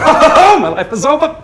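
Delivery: high hammed-up wailing shout; loud melodramatic despair; Scotty as sitcom character. Engineer in the boiler room, loud high despairing wail. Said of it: "Scotty down in the boiler room".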